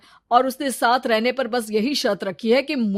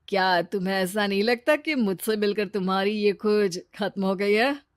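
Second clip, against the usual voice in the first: ordinary talk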